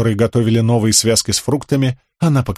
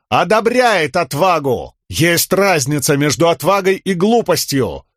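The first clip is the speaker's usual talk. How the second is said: крик; 6 semitones higher than usual. A narrator's reading. Рисн кричит Талику вверх.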